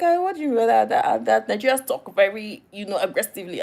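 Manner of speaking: high-pitched, playful protest; indignant comeback, played for laughs; mock indignation. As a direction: act affronted, starting high and loud. Retorts to a surprised stereotype.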